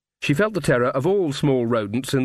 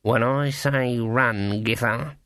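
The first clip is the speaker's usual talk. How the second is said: slow, tense undertone; through gritted teeth; much slower than usual. A narrator's reading. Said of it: "said between gritted teeth".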